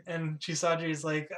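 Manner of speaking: ordinary talk, nothing marked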